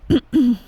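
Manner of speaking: pointed throat clear. Acted. Empress catching her slip mid-story, clearing her throat.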